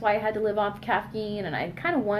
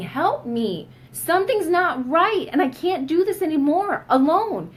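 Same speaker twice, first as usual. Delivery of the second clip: pleading, higher and louder; imagined cry to doctors for help, raised and desperate; mock desperate scream; 7 semitones higher, 5 dB louder, slower than usual. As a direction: beg for help, voice rising and straining. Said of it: "And you're screaming".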